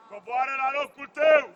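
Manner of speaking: very loud touchline shout; shouted order. Real, not acted. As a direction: shout the player back into position. Coach shouting at player. (ordin strigat foarte tare)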